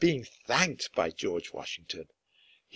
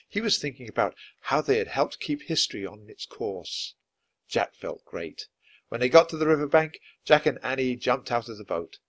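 plain reading voice

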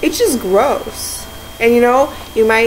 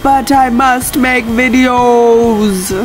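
slow, louder, far-off grand delivery; mock heroic declaration; 4 semitones higher, 4 dB louder, much slower than usual. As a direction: solemnly announce the duty. Dramatic mock-determined declaration.

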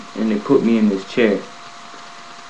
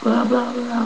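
high, heated rant that trails off; snapping; 13 semitones higher than usual. Retold or imitated. At the guards in his cell, retold snapping rant. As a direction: retell himself losing it at the guards. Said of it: "I'm snapping".

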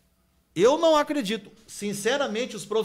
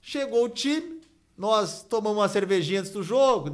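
ordinary delivery

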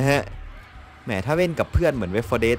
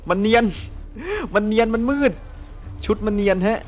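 protesting in a much higher voice; high-pitched complaint; 10 semitones higher than usual. Real, not acted. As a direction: complain high and insistent about what you can't see. Cannot see camouflaged enemy, complains. (บ่นเสียงสูงแบบโวยวาย)

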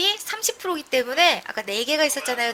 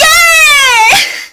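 loud joyful laughing scream; 환호하는 웃음 비명; 13 semitones higher, 14 dB louder than usual. Real, not acted. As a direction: burst into a shrieking happy laugh. 강화 성공에 환호. (기쁨에 찬 웃음 섞인 비명)

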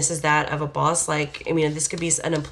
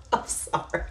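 whispered sorry; 6 dB quieter than usual. Real, not acted. Whispered apology after laughing.

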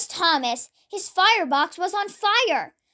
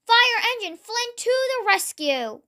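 crying out; 6 semitones higher than usual. A narrator's reading. Flynn comes to Gordon's rescue, crying out proudly. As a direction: announce the rescue up high. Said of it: "he cried".

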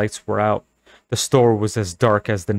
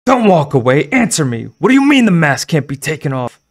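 louder, higher frantic yell; furious shouting; 6 semitones higher, 8 dB louder than usual. Acted. Girl yelling at the store owner walking away, frantic. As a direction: yell after him as he walks off.

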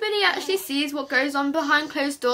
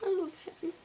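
tearful; 4 semitones higher, 11 dB quieter, much faster than usual. Real, not acted. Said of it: "sorry I'm crying".

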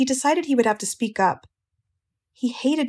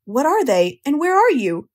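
louder, understated frantic demand; mild scream; 5 semitones higher, 5 dB louder than usual. A narrator's reading. Pig demanding answers from unseen voice, mildly screaming. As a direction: raise the voice in a restrained scream. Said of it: "screamed Wilbur".

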